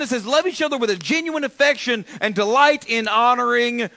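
high-pitched, urgent preacher delivery; impassioned preaching. Real, not acted. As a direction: drive it home, building to a loud exhortation. Preaches at full force.

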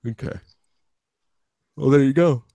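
speaking through a yawn. Real, not acted. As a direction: talk mid-yawn. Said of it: "Sorry, I'm yawning".

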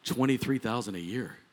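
stunned repeat. Real, not acted. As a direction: let "Twenty-three thousand a year" sink, quiet and heavy. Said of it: "I heard the sigh".

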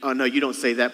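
ordinary talk, nothing marked

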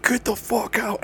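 high-pitched scream held back in volume; mock scream. Friend clearing out a house party, mock screaming. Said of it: "Kyle just fucking starts screaming".

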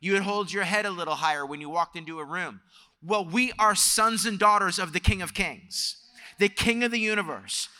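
high, emphatic sermon delivery; impassioned preaching. Real, not acted. Preacher declaring identity as children of the King, impassioned. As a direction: preach it with conviction, pitched well up.

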